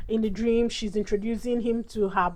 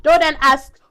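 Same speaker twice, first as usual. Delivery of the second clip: ordinary talk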